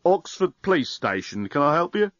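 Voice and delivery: tinny voice through a phone. Acted. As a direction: speak like over a phone line. Voice from mobile phone.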